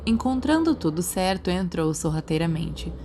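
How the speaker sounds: usual speaking voice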